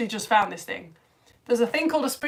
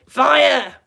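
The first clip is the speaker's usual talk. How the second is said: loud, higher burst on one word; excited exclamation; 4 semitones higher, 10 dB louder than usual. Retold or imitated. Reading a viewer's comment aloud, excited shout. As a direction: call it out like an alarm.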